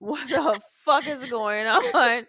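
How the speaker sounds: slow, laughing wail; hammed-up despair. Mother acting out her despair, slow laughing wail. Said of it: "I just started crying".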